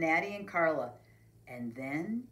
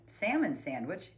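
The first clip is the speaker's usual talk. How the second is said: plain reading voice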